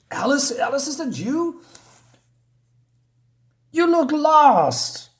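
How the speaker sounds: very high-pitched storybook caller; calling character voice. Acted. Dodo finding lost Alice in the forest, high calling voice. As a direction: put on a high voice, stretching the last words.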